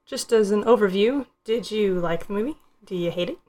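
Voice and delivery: ordinary talk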